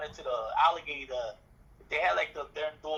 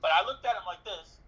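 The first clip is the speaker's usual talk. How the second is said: usual speaking voice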